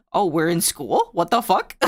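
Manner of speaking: ordinary talk, nothing marked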